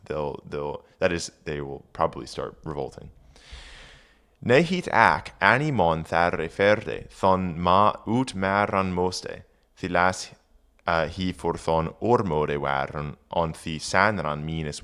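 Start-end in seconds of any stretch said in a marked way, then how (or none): 4.5-10.3 put-on reading Old English aloud; careful Old English recitation. Shown as a demonstration. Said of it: "reading Old English aloud".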